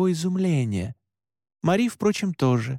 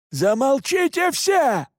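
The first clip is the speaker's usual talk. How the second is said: high, drawn-out shout; 12 semitones higher, 4 dB louder, slower than usual. A narrator's reading. Father Pascal silencing the commotion, shouting. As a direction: shout over everyone, stretching it out. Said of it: "крикнул отец Паскаль".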